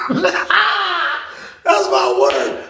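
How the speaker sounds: high, slow, wheezing laugh through the words; real laughter almost to tears; helpless laughter. Real, not acted. Swearing to viewers it's true, helpless laughter.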